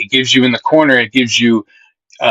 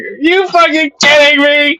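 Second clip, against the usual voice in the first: outraged yell. Retold or imitated. Retelling his reaction to Derek, outraged high yell. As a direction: blow up at the absurd line. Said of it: "I'm like".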